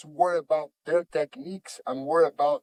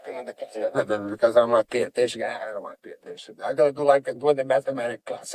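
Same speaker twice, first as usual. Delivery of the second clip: low, quick grumbling to himself; retold inner voice of an uninterested kid; disengaged student; 6 semitones lower, faster than usual. Kid tuning out in class because he won't pay attention, low disengaged muttering. Voices his bored younger self.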